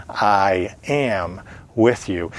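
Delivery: slow, low, hushed promise; tender voice of God. Acted. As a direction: speak it gently as God reassuring his people. Preacher voicing God's promise.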